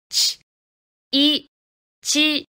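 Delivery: short voiceless ち burst, then い, then joined ち; chi sounded out. Shown as a demonstration. Teacher showing how chi is built, consonant then vowel then both. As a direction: build ち in three separate steps, consonant, vowel, syllable. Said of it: "ちの子音の発音のポイント".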